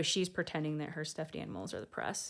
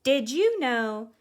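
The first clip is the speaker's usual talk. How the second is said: put-on lively read-aloud voice for children; storybook reading; 6 semitones higher, 10 dB louder than usual. A narrator's reading. Host reading a picture book to kids, lively storytelling. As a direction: read it like bedtime story time.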